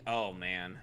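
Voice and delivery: ordinary delivery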